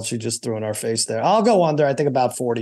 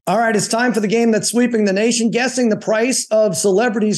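game show host; 7 semitones higher than usual. Acted.

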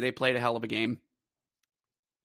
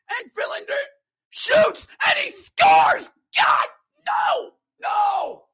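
high, crying shout; anguished goal call; 5 dB louder, slower than usual. Real, not acted. Bears announcer as Wilkes-Barre scores, anguished shouting. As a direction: howl as the goal goes in.

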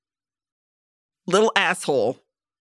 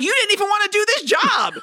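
high, incredulous jab at the FBI-miffed cops; mocking the cops for being annoyed at the FBI, high and incredulous; exasperated mock retort; 13 semitones higher, faster than usual. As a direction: scoff it up high in disbelief. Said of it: "And I'm like".

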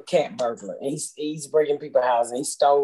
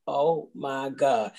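slow dawning shock; 3 semitones lower, 4 dB quieter, much slower than usual. Retold or imitated. Realizing the film was real.

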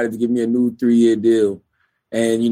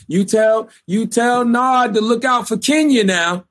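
put-on older lady voice; 10 semitones higher than usual. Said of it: "one of her friends called her and told her".

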